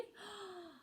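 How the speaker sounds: sharp gasp of delight; surprised gasp. Acted. Girl hears she can read to a dog, gasping. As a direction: catch your breath in surprise.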